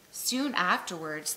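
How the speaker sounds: usual speaking voice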